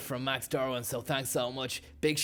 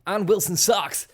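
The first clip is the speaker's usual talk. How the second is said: hammed-up race-day ad read, pushed louder on the product pitch; mock sponsor read; 2 semitones higher, 5 dB louder than usual. Host plugs absurd sponsor shoes, hammed-up ad read. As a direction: sell the shoes like a motorsport advert.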